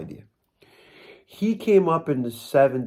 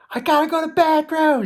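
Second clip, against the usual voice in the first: very high, loud comic yell; imitated yelling; 5 dB louder than usual. Dad on the dock yelling to his son, mock yell. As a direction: yell it as a desperate dad, played for laughs. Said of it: "He's, like, yelling".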